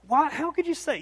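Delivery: high-pitched mock protest; Peter going on. Peter keeps arguing with Jesus, high mock-indignant protest. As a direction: imitate an indignant objection, voice shooting up. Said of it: "on and on he went".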